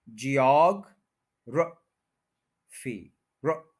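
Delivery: Geography broken into jee-AWG, ruh, fee with pauses; very slowly, long AWG, tiny "ruh" in geography; syllable by syllable. Shown as a demonstration. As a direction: teach geography piece by piece. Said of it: "बहुत short है".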